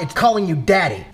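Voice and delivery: ordinary talk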